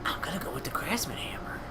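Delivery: high, hushed whispered announcement; soft stage whisper; playful whisper. Pretend. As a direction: whisper your choice like a secret. Answering in a put-on whisper.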